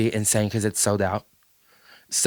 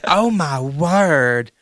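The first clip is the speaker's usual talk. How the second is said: slow, higher Southern drawl; mocking Southern accent; 4 semitones higher, much slower than usual. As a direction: indignantly quote it in a stretched Southern drawl. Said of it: "When have you ever heard me say".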